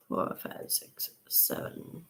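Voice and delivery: counting to herself in a whisper. Real, not acted. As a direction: whisper the count under your breath. Counting to herself while calculating.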